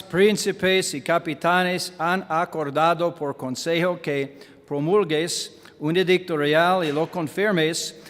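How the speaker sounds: ordinary talk, nothing marked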